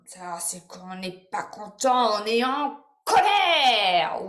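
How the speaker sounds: slow build to a loud, stretched growl of displeasure; hammed-up angry demonstration for children; mock anger. Shown as a demonstration. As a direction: play cross, swelling on the last word. Acts out anger while naming it.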